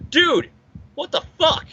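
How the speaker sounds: high-pitched mock disbelief, with a beat after dude; cartoonishly high, baffled outburst; incredulous reaction. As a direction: squeak out a stunned comic protest. Imagined reaction of comic character.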